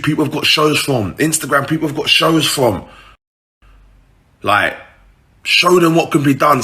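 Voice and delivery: hyping himself up not to quit; pep talk to himself. Retold or imitated. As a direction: gee yourself up, pushing harder as it goes. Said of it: "so I was saying to myself".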